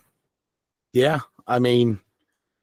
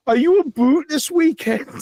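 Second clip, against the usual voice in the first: much higher and louder, overdone Canadian boot; exaggerated Canadian accent; 4 dB louder than usual. Friend from Canada asking about the weekend, in a shrieking mock Canadian accent. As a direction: push the Canadian vowel hard, squeaky and loud. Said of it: "she emphasizes it so much now".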